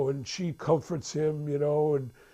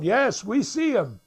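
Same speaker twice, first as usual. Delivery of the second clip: high-pitched church call-back; congrégation qui répond; 6 semitones higher, 4 dB louder than usual. Retold or imitated. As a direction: answer the preacher as an eager flock. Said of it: "they're all going".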